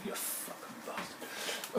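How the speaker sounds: slow, voiceless swearing under the breath; whispered curse. Real, not acted. Brewer cursing after an ow, pained whisper. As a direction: whisper it drawn out, in pain.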